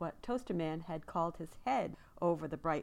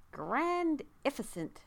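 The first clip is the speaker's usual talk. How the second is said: higher, slow and grand; proud exclamation; 9 semitones higher, 5 dB louder, slower than usual. A narrator's reading. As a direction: savour the made-up word. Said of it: "exclaimed Toaster".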